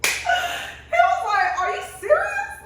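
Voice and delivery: high squealing laugh through the words; laughing hard. Retold or imitated. Laughing retelling friend's line.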